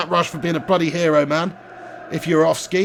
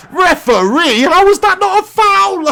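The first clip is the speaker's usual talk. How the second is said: much higher, loud outraged shout; real outrage at the referee; yelling at the ref; 10 semitones higher, 4 dB louder than usual. Real, not acted. Gamer yelling at the referee over a missed foul, outraged.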